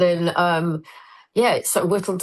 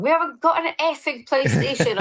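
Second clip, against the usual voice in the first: mock annoyed director; 8 semitones higher than usual. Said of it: "the director in your ear go".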